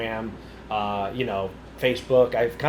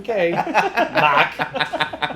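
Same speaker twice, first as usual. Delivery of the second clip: put-on Boston accent; 7 semitones higher, much slower than usual. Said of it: "I'm like".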